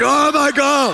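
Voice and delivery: high, loud mock recoil; mock horrified shout. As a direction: gasp it like someone hit by bad breath. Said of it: "And they're like".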